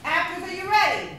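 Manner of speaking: high, loud rallying question; hype-up call. Real, not acted. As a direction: pump up the crowd. Hyping actors and audience.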